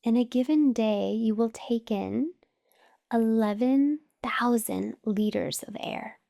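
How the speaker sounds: slow, measured meditation voice with long pauses; guided meditation. Acted. Teacher guiding listeners to notice their breath, calm meditation voice. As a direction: guide them unhurriedly through each breath, pausing between phrases.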